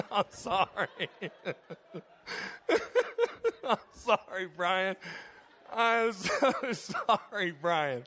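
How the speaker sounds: apologizing through real, uncontrollable laughter; helpless laughter. Real, not acted. Preacher apologizing to Brian after teasing him, helplessly laughing. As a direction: crack up on the words, dragging them out between laughs.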